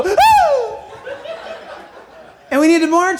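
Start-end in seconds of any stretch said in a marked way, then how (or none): 0.0-0.8 high, loud "ooh"; mock startled whoop. Church reacting to the enemy's boom, mock startled whoop. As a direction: shoot up into a held hoot. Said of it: "and we go".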